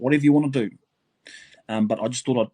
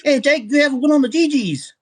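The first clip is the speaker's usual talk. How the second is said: movie guy's call; 12 semitones higher, 6 dB louder than usual. Retold or imitated. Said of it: "the guy goes".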